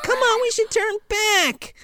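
very high, soft, slow pleading; timid boy. Retold or imitated. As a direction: quietly beg to turn back. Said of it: "He's like".

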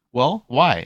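loud high mock indignation; mom's indignant challenge. Mom demanding answers, loud mocking voice. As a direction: throw the question back.

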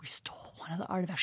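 fast hushed whisper; hurried whispered confession; secret whisper. Retold or imitated.

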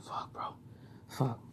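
near-silent despairing curse; defeated under-the-breath swearing in handcuffs; muttered despair. Retold or imitated. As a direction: breathe the curse out, almost inaudible.

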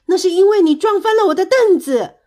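high, very loud shout; furious, high-pitched bellow; angry roar. A narrator's reading. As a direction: yell the blame back. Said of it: "蹦蹦大声吼道".

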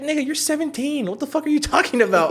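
very high incredulous scolding; retold exasperation. Scolding seventeen-year-old brother for tattling, retold exasperation. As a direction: act out berating a snitch.

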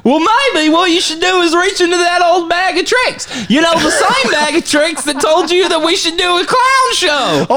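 hammed-up clown banter. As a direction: keep the clown act going. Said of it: "your clown voice sure is weird".

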